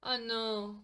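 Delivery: normal talking voice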